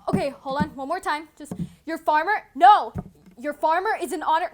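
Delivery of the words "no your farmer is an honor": ordinary delivery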